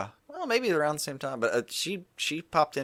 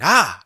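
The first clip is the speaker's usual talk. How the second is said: mock yelp; 8 semitones higher, 8 dB louder than usual. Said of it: "and went".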